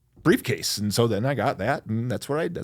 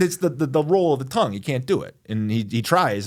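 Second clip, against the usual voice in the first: ordinary delivery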